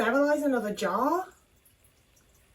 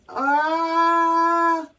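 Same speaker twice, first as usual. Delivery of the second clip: long, high, loud "ah" of nerves; scared cry; 7 semitones higher, 7 dB louder than usual. Real, not acted. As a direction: hold a nervous wail, loud and high. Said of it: "I'm scared".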